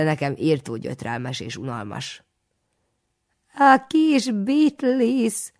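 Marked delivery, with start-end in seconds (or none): 3.5-5.5 high, very slow crone voice; toothless old woman. A narrator's reading. Old woman at the market cackles high and slow. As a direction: croak it like a toothless granny. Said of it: "mondta egy fogatlan öregasszony".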